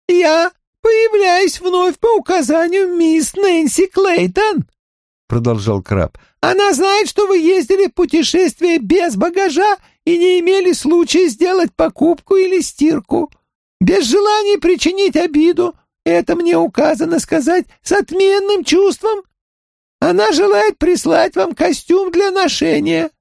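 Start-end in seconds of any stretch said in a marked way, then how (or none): 0.1-4.7 very high monotone alien voice; high, flat and mechanical, like a crab creature. Acted. 6.4-23.1 very high monotone alien voice; high, flat and mechanical, like a crab creature. Acted.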